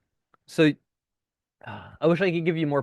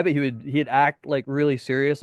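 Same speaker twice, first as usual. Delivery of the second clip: usual speaking voice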